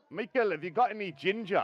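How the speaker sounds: high, loud Scottish question; retelling the coworker in Scottish; imitating Scottish coworker. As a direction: mimic a broad Scottish coworker asking. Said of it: "she come up to me one day and went".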